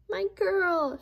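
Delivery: high, loud happy yelp; joyful yelp. A narrator's reading. Dog Daisy greets her girl, high and loud. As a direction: squeal with delight. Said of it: "she yelped".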